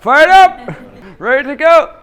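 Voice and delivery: loud, high chant call; rallying shout. Retold or imitated. Coach echoing the speech to the class, rallying shout. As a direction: shout it like firing up a crowd.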